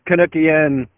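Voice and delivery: usual speaking voice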